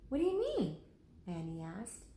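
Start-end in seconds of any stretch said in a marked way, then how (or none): 0.1-0.8 put-on high girlish puzzled voice; genuinely confused; Annie's puzzled question. Annie asks Lincoln what he means: high puzzled girl's voice.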